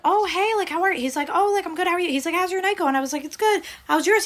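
high-pitched imitated pleasantries; put-on small talk. Running into an avoided date, high awkward small talk. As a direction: act out polite chit-chat way up high.

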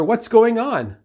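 high-pitched, retold alarmed question. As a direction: act out a worried neighbor asking. Woman questions her screaming neighbor.

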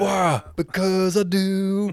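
high, slow mock whine of a cartoon kid; whiny kid. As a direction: drag it out like a sulky kid.

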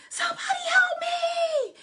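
high-pitched, loud cry for help; acted shriek of someone in terror; panicked screaming. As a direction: scream desperately for rescue. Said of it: "They were screaming and crying out".

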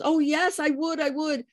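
high, eager retold yes; eager agreement. Birth mother agreeing to meet her daughter, eager. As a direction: agree keenly, imitating a thrilled mother.

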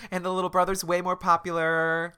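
high, sarcastic aside. Real, not acted. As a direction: tease it in a high voice.

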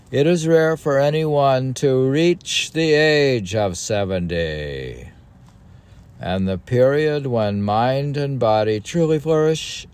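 put-on high, loud, solemn recitation of old text; declaiming recital. Reading an ancient text aloud to a co-host, solemnly declaimed. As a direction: read it like scripture from a lectern.

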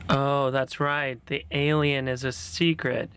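usual speaking voice

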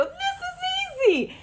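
high, slow, drawn-out boast; cocky boast. Retold or imitated. Throwing hands up at lunch, a high slow cocky brag. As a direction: throw it off like it's nothing, up high. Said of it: "I go".

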